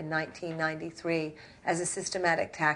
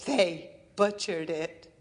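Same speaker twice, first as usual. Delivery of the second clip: raised and slowed, climbing in pain as horrors pile up; dramatic monologue; 6 semitones higher, slower than usual. Acted. Performer voicing a Bosnian woman to the audience, rising anguish. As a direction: speak as the survivor, memory breaking into anguish.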